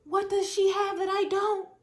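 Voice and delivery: high hammed-up pleading with gasps and sobs; mock desperate ex. Mocking the jealous ex begging for the lover back, high and teary. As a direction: whine like a jilted ex begging.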